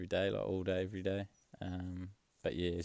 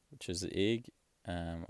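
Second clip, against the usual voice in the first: usual speaking voice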